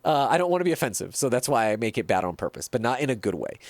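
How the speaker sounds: usual speaking voice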